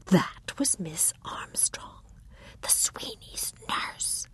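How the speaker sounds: slow hushed whisper. Acted. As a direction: breathe it out, barely voiced. Irene hushed.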